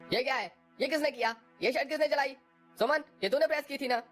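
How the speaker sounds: high, furious questioning; the husband rages at his wife over the burnt shirt; sharp angry husband; angry demand. Acted.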